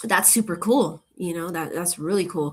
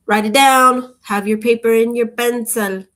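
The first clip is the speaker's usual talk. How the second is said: emphatic call; 4 semitones higher, 9 dB louder than usual. Real, not acted. Urging viewers to note signs.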